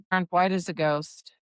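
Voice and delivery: ordinary delivery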